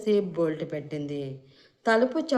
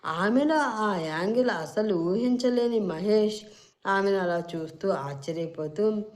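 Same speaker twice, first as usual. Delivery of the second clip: ordinary delivery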